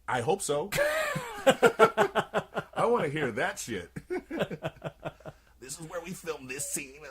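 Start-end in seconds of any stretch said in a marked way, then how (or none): 0.7-4.7 laughing hard through the words; can't stop laughing. Real, not acted. 5.6-7.0 high, slow creepy killer voice; mock horror-villain commentary; Billy's creepy voice. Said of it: "Billy's voice in the commentary".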